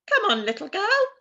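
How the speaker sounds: high, loud bird voice; talking crow. Acted. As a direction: crow calling down to the little girl. Crow speaks to Gerda.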